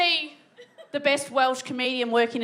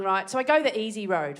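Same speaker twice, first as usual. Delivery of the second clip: ordinary delivery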